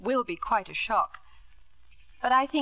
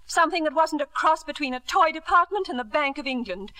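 raised, scornful voice; bitter outburst; 3 semitones higher, 4 dB louder than usual. Acted. Wife attacking husband's idea of home, bitter raised voice. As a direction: throw the word home back at him.

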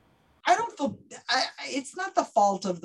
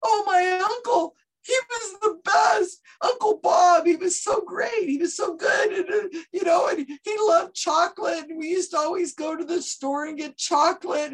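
gushing sitter; 7 semitones higher, 3 dB louder than usual. Retold or imitated. Sitter telling the psychic about her uncle, mock gushing. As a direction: overplay a grieving fan. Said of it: "you're saying".

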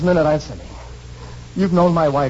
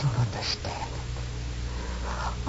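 stunned whisper; 2 semitones lower, 13 dB quieter, much slower than usual. Acted. Bewildered man, voice dropping.

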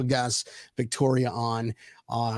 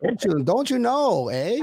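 high, swooping mock Minnesota lilt; Minnesota accent; 5 semitones higher than usual. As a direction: ham up a Minnesota-Canadian accent. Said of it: "Welcome to Minnesota".

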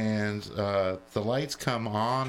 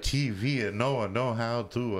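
heavy mock Italian accent; broken English in a thick Italian accent; Trudoni. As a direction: play an old Italian woman with a caricatured accent. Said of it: "I'm talking like Trudoni".

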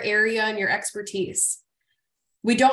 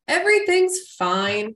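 forced fine; 5 semitones higher, 4 dB louder, slower than usual. Retold or imitated. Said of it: "I called myself the queen of fine".

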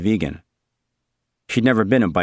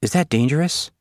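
put-on worried girl; 6 semitones higher than usual. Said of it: "Suzanne said".

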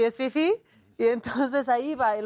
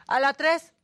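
curt airport attendant; 4 semitones higher, 3 dB louder than usual. Retold or imitated. Airport staff directing passengers.

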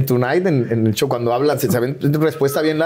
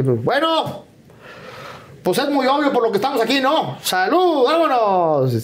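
high, blunt ranchero dad; hammed-up high rancher father; 10 semitones higher, slower than usual. Rancher dad's blunt toast voiced.

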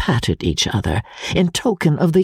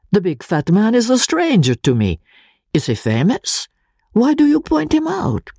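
higher, lively voice of a puzzled little professor; curious Italian professor voice; excitable Italian professor; 9 semitones higher than usual. Acted. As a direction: play the bewildered friend asking why. Pesca voiced by narrator.

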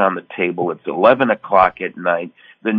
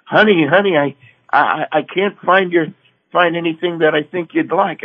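wife; 8 semitones higher than usual. Retold or imitated. Wife calling from Florida, voiced.

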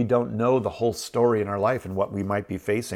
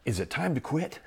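softer, wavering inner voice asking to quit; doubtful self-question; 3 semitones higher, 6 dB quieter than usual. Retold or imitated. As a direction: mutter the doubt to yourself, worn down. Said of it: "I would have started to ask myself".

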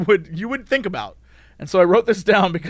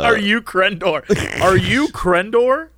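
slow mock question; slower than usual. Mocking the fans' question.

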